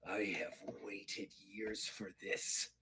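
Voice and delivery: slow, low near-whisper; hushed menace. Acted. Klingon face to face with his rival, hushed menace. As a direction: breathe it out slowly, very low.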